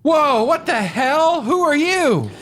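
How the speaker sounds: high, startled yelp. Acted. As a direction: jump at the sudden ghoul. Ray startled by ghoul.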